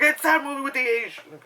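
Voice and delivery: mock clueless viewer. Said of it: "They're like".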